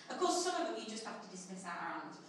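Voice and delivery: usual speaking voice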